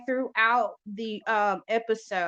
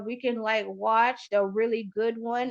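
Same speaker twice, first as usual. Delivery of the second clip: ordinary talk, nothing marked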